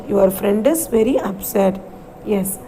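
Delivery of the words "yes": usual speaking voice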